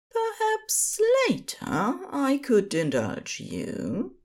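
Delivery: lightly voiced tender angel. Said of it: "Aziraphale offers".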